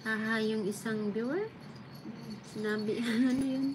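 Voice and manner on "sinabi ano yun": usual speaking voice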